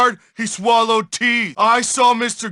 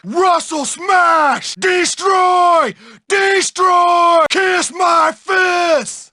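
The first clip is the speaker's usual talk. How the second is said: high, drawn-out battle shout; raging brute; 5 semitones higher, slower than usual. Acted. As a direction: roar it like a smashing brute. Bully goes on a rampage.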